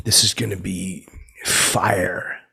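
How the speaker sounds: normal talking voice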